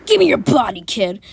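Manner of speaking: put-on grab for the kid's body; menacing sludge villain. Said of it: "be like".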